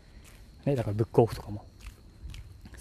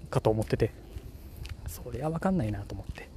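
ordinary talk

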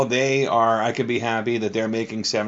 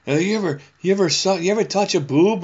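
put-on higher teasing kid voice; boys bragging; 9 semitones higher, 4 dB louder than usual. Man voices the bragging schoolboys, higher and teasing. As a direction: ask it like a swaggering boy. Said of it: "And they were like".

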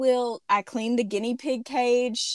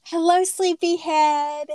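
tender greeting; 7 semitones higher, 5 dB louder, slower than usual. Real, not acted. Mother greeting sleepy daughter.

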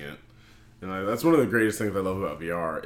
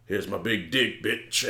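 menacing Star Destroyer; slower than usual. Retold or imitated. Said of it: "the Star Destroyer's just like".

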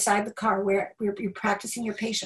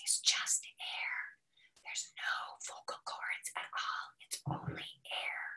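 pure breathy whisper, no voice at all; soft unvoiced teaching whisper; 16 dB quieter, slower than usual. Shown as a demonstration. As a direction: show them a whisper that is just air. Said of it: "how to use a whisper voice".

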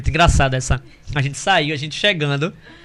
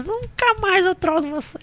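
high, slow sweet promise; girlfriend's promise; 13 semitones higher, 3 dB quieter, slower than usual. Retold or imitated. Imitating girlfriend promising after the flowers. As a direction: coo it like making up.

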